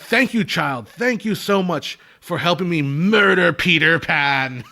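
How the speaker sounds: slow, haughty, syrupy villain thanks; Captain Hook. Retold or imitated. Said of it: "he's like".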